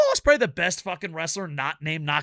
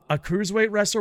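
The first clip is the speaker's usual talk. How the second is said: ordinary delivery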